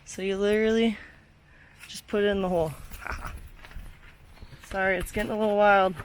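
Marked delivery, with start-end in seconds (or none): none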